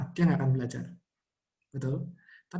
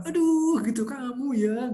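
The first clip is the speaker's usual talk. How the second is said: "Aduh" jumps much higher, a mock irritated exclamation; annoyed scolding; 8 semitones higher, slower than usual. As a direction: imitate a parent scolding over a torn sticker. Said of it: "kita merasa".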